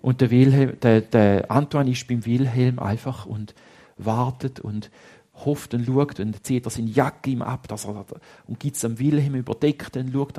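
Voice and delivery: usual speaking voice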